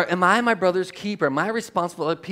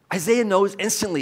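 ordinary talk

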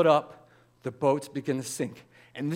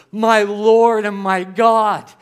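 slow, loud, higher cry of worship; awed declaration; 6 semitones higher, 13 dB louder, much slower than usual. Acted. Peter recognizing Jesus, slow loud awed cry. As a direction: proclaim it with full awe, stretched out.

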